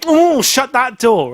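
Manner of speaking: high, slow, drawn-out catchphrase; Larry Grayson. Retold or imitated. Host imitates Generation Game presenter for guest, exaggerated catchphrase. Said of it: "He used to go".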